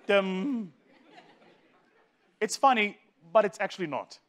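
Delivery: ordinary talk, nothing marked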